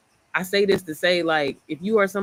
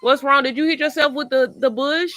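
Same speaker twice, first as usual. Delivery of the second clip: concerned mom; 7 semitones higher, 4 dB louder than usual. Real, not acted. Child hurt outside.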